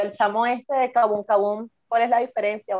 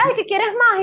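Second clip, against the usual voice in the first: high mock host; 10 semitones higher than usual.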